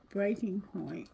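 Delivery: Breaking point whispered softly, breath only. Shown as a demonstration. Tarot reader repeats the card's words to viewers, whispered. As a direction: let "Breaking point" fall to a soft whisper.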